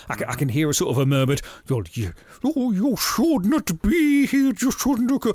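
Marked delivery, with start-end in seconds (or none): 1.7-5.3 high, slow mock Scottish woman; imitating the actress's terrible Scottish accent; mock bad Scottish accent. Podcaster mocking a TV actress's bad Scottish accent, hammed-up. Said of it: "this is literally her accent".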